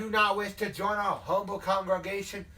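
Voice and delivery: forceful southern pulpit challenge; southern hellfire preacher. Acted. Leader challenging a reluctant recruit, southern hellfire preaching. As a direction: confront him like a southern revivalist. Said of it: "like, the hellfire and brimstone thing".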